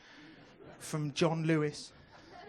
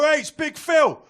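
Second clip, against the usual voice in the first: loud, high hail across the pub; hearty shouted greeting; 8 semitones higher, 13 dB louder, slower than usual. Acted. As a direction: call out the nicknames like a rowdy mate. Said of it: "someone will come in and go".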